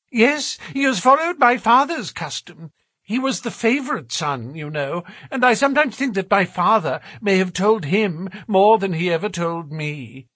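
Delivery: high-pitched, stammering bafflement; uneasy gentleman. Acted. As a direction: fret in a high, troubled voice. Voices Thaddeus Sholto explaining.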